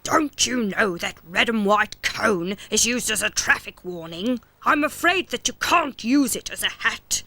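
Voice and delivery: police officer voice. Acted. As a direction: lay down the law gently. Police blob character voice.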